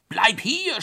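high, loud call to stop; shouted order. A narrator's reading. Narrator shouting after the hare on the ice, high. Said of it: "schrie ich".

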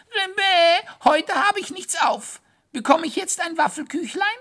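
very high, far squeaky voice, whining; a tiny hare wailing about school, high and loud; whiny little hare. Acted. Hare begging for waffle.

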